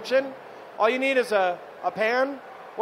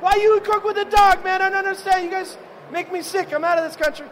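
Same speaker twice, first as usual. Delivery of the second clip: much higher, loud, mock Italian outrage; outraged Fabio; 9 semitones higher, 3 dB louder than usual. Fabio arguing with Danny, hammed-up outraged Italian voice. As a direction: play a disgusted Italian chef storming off. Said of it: "He's like".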